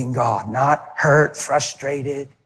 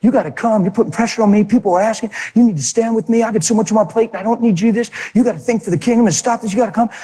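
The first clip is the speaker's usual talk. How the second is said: higher, pushy nagging plea; raised voice of a man pushing his pastor; pleading husband voice; 7 semitones higher, faster than usual. Retold or imitated. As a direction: press him hard, a bit breathless. Preacher voices pressuring husband.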